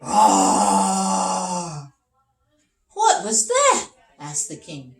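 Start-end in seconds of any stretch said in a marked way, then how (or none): 0.0-1.9 a big, loud animal roar. Acted. A beast roars at the travellers, loud. 2.9-3.9 very high, loud, alarmed; startled king. Acted. The king is startled by the roar, very high and loud.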